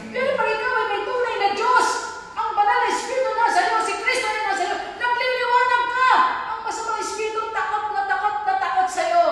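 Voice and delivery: loud, high-pitched preaching climb; ringing pulpit proclamation; full-force preaching. Acted. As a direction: preach it out to the congregation.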